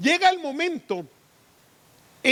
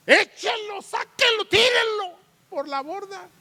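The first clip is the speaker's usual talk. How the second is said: frantic shout; 6 semitones higher than usual. Acted. Sailors yelling to throw Jonah.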